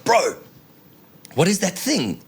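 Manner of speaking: high-pitched incredulous exclamation; acted-out wide-eyed amazement; astonished outburst. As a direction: blurt it out high, amazed at the sight. Acted astonishment at strange camera.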